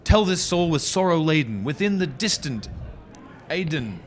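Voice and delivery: high, worked-up pleading; hammy stage actor. Acted. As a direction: overwrought theatrical plea. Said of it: "getting yourself a little worked up".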